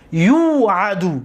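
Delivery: teacher's lengthened model of یوعدو; drawn-out demonstration. Shown as a demonstration. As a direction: stretch the long first syllable of یوعدو. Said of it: "دقت داده؟".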